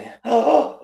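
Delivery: mock shocked gasp. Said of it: "like it was real racist".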